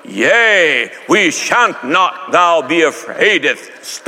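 slow pompous olde English; mock archaic disciples. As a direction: ham up fake Bible speak. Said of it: "And they go".

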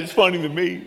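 amused villain voice breaking into laughter; villain enjoying his own joke; laughing villain. Retold or imitated. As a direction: gloat with a chuckle. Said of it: "I said".

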